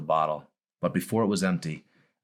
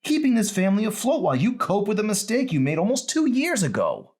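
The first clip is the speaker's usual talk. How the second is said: put-on high, hurt teenage daughter, raised; a daughter voice pitched well up, accusing; Emery's voice; 5 dB louder than usual. Daughter confronting her father, high and hurt.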